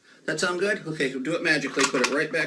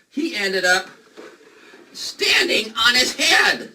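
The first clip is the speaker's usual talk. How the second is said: mock alarm; 6 semitones higher, 5 dB louder, slower than usual. Bunny trick goes wrong, mock alarm.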